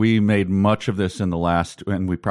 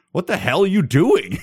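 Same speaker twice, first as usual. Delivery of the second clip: sudden high, loud "what the hell"; shocked, higher and louder demand; startled outburst; 9 semitones higher, 3 dB louder than usual. Pretend. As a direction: catch someone in your kitchen and blurt it out. Barging in on naked cereal eater.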